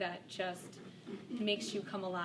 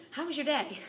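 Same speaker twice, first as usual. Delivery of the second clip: bright mock-cheery question; 8 semitones higher, 7 dB louder than usual. Mother chirpily asks her son.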